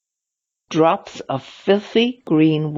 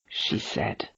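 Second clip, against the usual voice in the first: ordinary delivery, nothing marked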